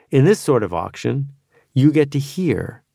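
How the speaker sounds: ordinary talk, nothing marked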